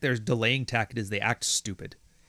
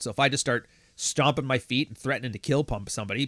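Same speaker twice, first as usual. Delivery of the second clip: usual speaking voice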